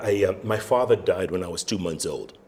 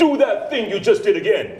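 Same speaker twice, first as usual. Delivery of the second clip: much higher and louder, laughing; excited insistence; 11 semitones higher, 7 dB louder than usual. Retold or imitated. Instructor urging the trainee, excited and laughing. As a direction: push him to repeat it, thrilled.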